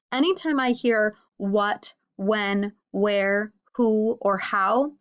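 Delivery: what, when, where, who, how each spelled out slowly with a pause; slow listing of question words. Shown as a demonstration. Teacher slowly listing what, when, where, who, how for students. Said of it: "I know that the author is asking".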